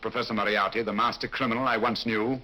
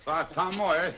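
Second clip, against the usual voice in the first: rough foreign sailor, higher pitched; disguised sailor voice; 7 semitones higher than usual. Acted. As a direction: growl it like a dockside thug. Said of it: "An admirable disguise".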